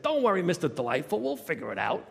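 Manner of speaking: hearty Brooklynite, loud and high at first; booming, back-slapping Brooklyn encouragement; Sal. Retold or imitated. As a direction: imitate a big Brooklyn coworker's loud reassurance. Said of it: "a huge barrel-chested Brooklynite".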